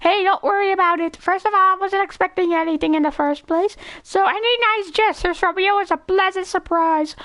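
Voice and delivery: high-pitched cartoon character voice; goofy, high impression reading; Carl Weezer impression. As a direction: put on a silly high voice throughout. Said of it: "Carl Weezer, twenty twenty-five".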